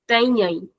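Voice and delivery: Tenhém with em sounded twice; doubled nasal ending. Shown as a demonstration. Teacher repeating the alternative form, doubled Tenhém. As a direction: stretch Tenhém into two nasal syllables. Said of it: "sound of em being doubled".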